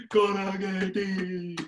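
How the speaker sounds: slow, stretched-out bellow of the word; dim giant ape cry. Acted. King Kong spots the flying iron, slow stretched bellow.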